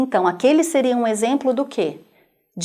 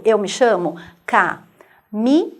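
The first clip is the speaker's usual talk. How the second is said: slow, each syllable split apart; 3 semitones lower, slower than usual. Shown as a demonstration. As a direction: clap out every syllable of "eu me chamo Camila". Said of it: "batendo palmas e dizendo o seu nome".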